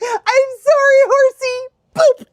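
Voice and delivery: high, loud comic wail; mock distressed apology. Scared rider apologizing to the horse, high mock wail. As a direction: apologize in exaggerated anguish.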